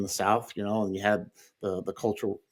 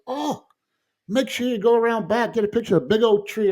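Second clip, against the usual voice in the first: high, cheerful voice of a friendly neighbor; friendly homeowner; 10 semitones higher, 3 dB louder than usual. Retold or imitated. Homeowner telling the visitor to go around back, high and cheerful. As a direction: chirp it like a kind, eager host.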